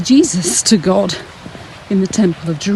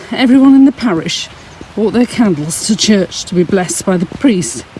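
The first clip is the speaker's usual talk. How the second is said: normal talking voice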